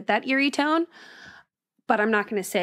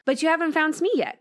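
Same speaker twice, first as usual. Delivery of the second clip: whiny protest, higher; complaining; 7 semitones higher than usual. A narrator's reading. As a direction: complain it like a sulky player. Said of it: "complained Jackson".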